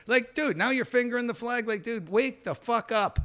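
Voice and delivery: high-pitched exasperated rant; worked-up, incredulous scolding; indignant rant. Retold or imitated. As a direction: vent at the clueless driver, pitch pushed up. Rant at driver fingering his flag.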